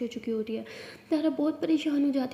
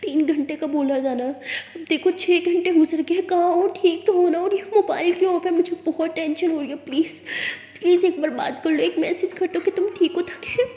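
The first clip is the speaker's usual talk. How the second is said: tearful, pleading, slowed down; crying voice message; 4 semitones higher, 6 dB louder than usual. Acted. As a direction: plead through tears, worried sick. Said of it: "तारा उस message में बहुत ज़्यादा रो रही होती है". (रोते हुए परेशान आवाज़ में मिन्नत)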